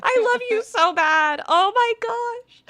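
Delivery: high, choked-up crying through the words; real crying. Real, not acted. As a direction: let the tears break into the voice. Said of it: "you're gonna make me cry".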